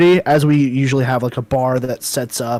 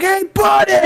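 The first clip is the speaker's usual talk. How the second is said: calling out across a distance. Retold or imitated. Guy from the video, calling to a stranger far away. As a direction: hail someone across the street. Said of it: "He was like".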